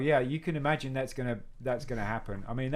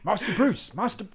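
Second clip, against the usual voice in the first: butler voice; 8 semitones higher, slower than usual. Retold or imitated. Playing Batman's butler Alfred.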